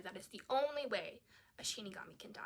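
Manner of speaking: fast, hushed insistence; a fan's hushed correction; emphatic whisper. Real, not acted. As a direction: whisper it like a secret rule.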